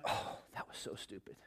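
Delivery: whispered self-reproach under the breath; muttered regret. Retold or imitated. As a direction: sigh it to yourself as a regret. Regretting the tree work.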